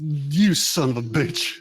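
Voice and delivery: slow, drawn-out cursing; acted outrage. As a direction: play the indignant slap victim. Game master voices Robinson's outrage.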